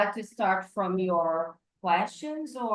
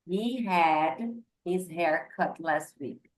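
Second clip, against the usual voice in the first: slow model sentence; slower than usual. Shown as a demonstration.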